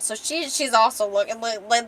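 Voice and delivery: plain reading voice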